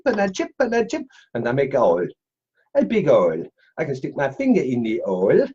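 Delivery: silly put-on French accent. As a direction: slip into a playful mock French accent. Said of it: "slipping into stupid accents".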